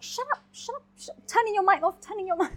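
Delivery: high mock-exasperated teacher telling kids to shut up; hammed-up frazzled teacher voice, high; imitating a fed-up teacher. Teacher muting noisy kids online, mock exasperated. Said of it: "I'm just like".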